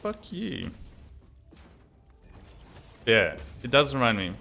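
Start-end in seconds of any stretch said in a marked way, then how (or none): 0.0-0.7 mock annoyance. Real, not acted. Annoyed at the game voice.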